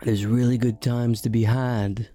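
slow, hushed ad announcer read; mock advertisement. Host jokingly reads an ad for his guest's family tours, as a slow ad announcer. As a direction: sell it like a late-night tour ad. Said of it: "So we're gonna do an advertisement right now".

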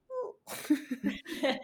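bright high burst of laughter; soft high laugh breaking the tension. Real, not acted. Laughing at her own fear.

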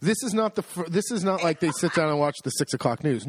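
usual speaking voice

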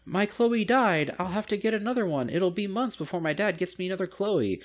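high-pitched mock little-girl lament. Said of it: "a little girl's asking you".

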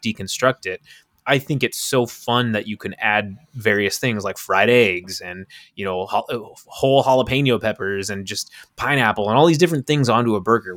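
usual speaking voice

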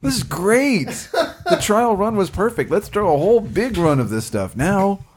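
high, laughing fake enthusiasm; mock brewer. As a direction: ham up a proud brewer. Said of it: "somebody taste tested that ... and said".

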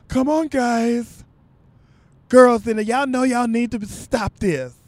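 lightly camp plea to stop the fight; imitating the good-looking friend. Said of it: "That's just how he sounded".

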